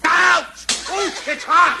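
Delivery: sudden high yelp of pain. Acted. Husband burned by hot tea glass, yelping. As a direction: cry out as fingers get scalded. Father burns hand on glass.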